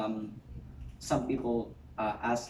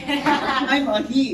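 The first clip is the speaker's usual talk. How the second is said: laughing; 12 semitones higher, 11 dB louder, much slower than usual. Real, not acted. Joking about own gender.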